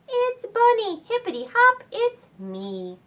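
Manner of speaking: high, bouncy bunny voice. Acted. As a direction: hop through it like a bunny. Bunny reveals itself.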